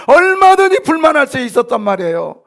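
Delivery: loud, high, emphatic pulpit point; forceful preaching. Real, not acted. Pastor explaining how easily they could complain, forceful preaching. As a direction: hammer the point home at full voice.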